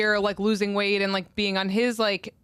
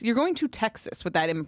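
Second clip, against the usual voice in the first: ordinary talk, nothing marked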